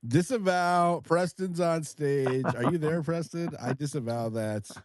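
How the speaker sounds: high, laughing mock protest; laughing disavowal. Real, not acted. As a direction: call to Preston while still laughing. Jokingly disowns the remark.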